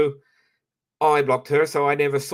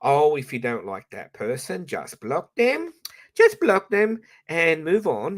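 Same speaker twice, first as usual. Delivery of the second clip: mock advice; 6 semitones higher than usual. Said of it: "all the people tell you to do".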